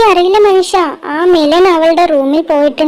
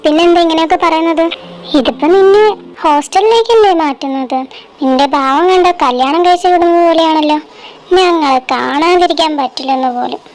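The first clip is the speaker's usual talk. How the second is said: ordinary talk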